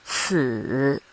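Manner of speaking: Ci dipping low, then rising; third tone. Shown as a demonstration. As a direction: drop Ci low and lift it. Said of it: "this is how we mark the tones".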